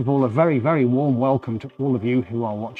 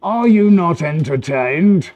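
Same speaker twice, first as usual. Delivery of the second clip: loud, slow, raised mock-epic challenge; Russell Crowe; 5 semitones higher, 6 dB louder, slower than usual. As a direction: declaim it like a movie hero rousing a crowd. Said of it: "as the great Russell Crowe once said".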